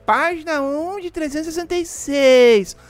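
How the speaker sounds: high, slow mocking whine; whiny mock voice. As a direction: drag it out like an annoying complainer. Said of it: "Não aguento aqueles caras que já começam".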